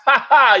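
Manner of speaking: loud, high burst of laughter; amused cackle at a remembered joke; real laugh. Real, not acted. Amused reading a viewer's comment.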